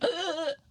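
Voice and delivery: high gasping hiccup; sudden hiccup-like intake; imitated hiccup. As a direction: mimic an uncontrollable hiccup. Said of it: "I can't stop hiccuping".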